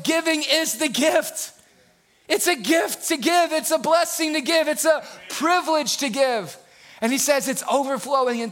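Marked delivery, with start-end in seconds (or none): 0.0-6.6 high, exclaimed pulpit delivery; fired-up preacher in full voice; excited preaching. Real, not acted.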